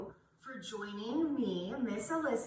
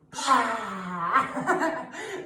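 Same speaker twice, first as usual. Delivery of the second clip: loud breathy roar with tongue out; lion's breath roar; 5 dB louder than usual. Shown as a demonstration. Teacher leads kids in a loud breathy lion roar.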